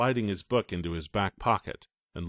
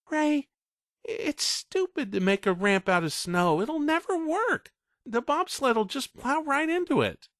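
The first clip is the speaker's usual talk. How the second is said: put-on much higher, small boy's voice; young boy objecting. Eight-year-old Todd arguing with his brother, small voice. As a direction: push back timidly. Todd's child voice.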